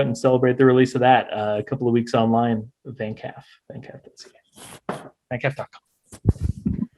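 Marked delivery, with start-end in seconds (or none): 2.9-4.9 playful whisper. Acted. Spelling out the festival website. 5.3-6.9 slow, drawn-out whisper of the web address. Acted. Plugging the festival site to viewers, slow whisper.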